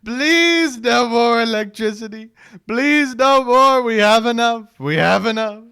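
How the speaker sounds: high, loud, slow whiny pleading; mock begging. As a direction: beg shrilly in a mock panic. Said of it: "He's so good".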